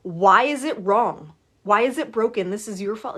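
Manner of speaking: hammed-up annoyed rant at the phone; mock annoyed complaint. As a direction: play the irritated caller. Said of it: "you're annoyed and you're like".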